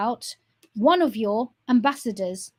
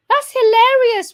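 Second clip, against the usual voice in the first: sarcastic amusement; 10 semitones higher, 10 dB louder, slower than usual. Real, not acted. Laughing at their removed people.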